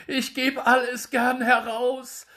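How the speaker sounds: hoch und laut, flehend; kleinlaut gerufen. Acted. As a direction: bettle hastig um Gnade. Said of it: "rief der Wirt ganz kleinlaut". (der geschlagene Wirt fleht den Drechsler hoch und verängstigt an)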